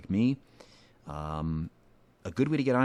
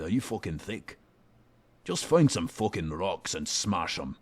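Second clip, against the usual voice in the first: gruff Scottish smith. Acted. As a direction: growl it out like a crude dwarf smith. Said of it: "vary it up for him".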